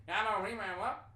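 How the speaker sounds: loud, garbled West Virginia mumble; hammed-up West Virginian gibberish, words run together; mock West Virginian accent. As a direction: slur it into unintelligible West Virginian. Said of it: "can't understand West Virginian accent".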